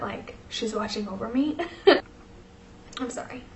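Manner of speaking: fighting back tears; choked up. Real, not acted. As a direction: voice catching, half-whispered apology. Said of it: "I wasn't expecting to get this emotional".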